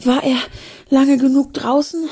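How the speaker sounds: breathless. Acted. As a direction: ask it as if out of breath. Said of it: "fragte Ben atemlos".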